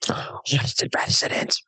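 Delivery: voiceless, delighted whisper; awed whisper. Acted. Artist hearing of a residence, awed whisper. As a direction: whisper it as if savouring the title. Rosalie thrilled at the title.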